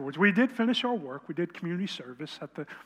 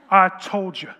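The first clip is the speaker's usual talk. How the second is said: loud, gloating I told you; triumphant shout; 12 dB louder than usual. Retold or imitated. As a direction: crow it out, you were right. Boyfriend vindicated after the reveal.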